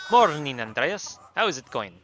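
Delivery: put-on odd, failed-German accent, punched first word; weird made-up accent. Klaus greeting Andreas in the morning, weird improvised accent. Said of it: "I'll do some weird shit".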